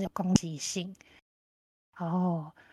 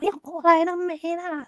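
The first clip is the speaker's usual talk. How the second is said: raised, high-pitched imitation of a demanding caller; deliberately loud; 9 semitones higher, 4 dB louder than usual. Friend on the rescue phone call, deliberately loud. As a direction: call it out high, as if meant to be overheard. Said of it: "他就故意讲得很大声说".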